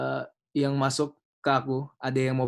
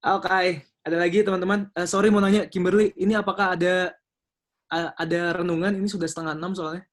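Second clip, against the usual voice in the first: raised host voice; 6 semitones higher, 4 dB louder than usual. Real, not acted. Host moving the session along.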